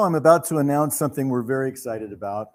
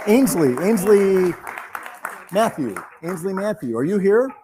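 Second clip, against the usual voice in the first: loud, higher call across the room; calling out a name; 5 semitones higher, 5 dB louder than usual. Real, not acted. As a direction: call the winner's name out to the hall. Calling a winner from the audience.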